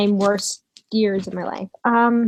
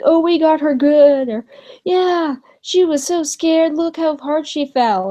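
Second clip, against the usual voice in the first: imitating gloating kids, higher-pitched; mock the bullies crowing over a prank, pitched up; mengejek; 6 semitones higher, 3 dB louder than usual. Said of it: "I heard them laughing like".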